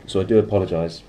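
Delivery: throat still catching; after a coughing fit. Real, not acted. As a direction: talk through a tickly throat.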